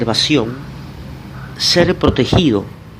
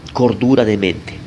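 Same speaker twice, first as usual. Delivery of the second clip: ordinary talk, nothing marked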